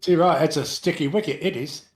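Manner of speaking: loud mock Cockney quip; chirpy Cockney voice; Cockney accent. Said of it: "an Italian Cockney accent".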